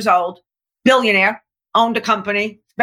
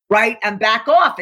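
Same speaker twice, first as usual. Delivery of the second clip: normal talking voice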